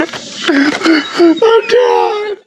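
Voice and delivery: hammed-up tearful shout; mock crying wail. Overwhelmed by the animals.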